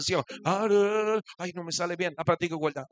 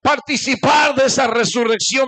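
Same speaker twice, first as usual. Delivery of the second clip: rising to a full preaching shout; 6 semitones higher, 10 dB louder than usual. Acted. Preacher to congregation, voicing Paul, shouting. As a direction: build to a roaring climax as Paul.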